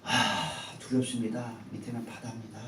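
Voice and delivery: high, urgent preaching of the fall; dramatic storytelling. Acted. Pastor dramatizing the young man's fall for the church, high and urgent. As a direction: tell it breathlessly, as if falling yourself.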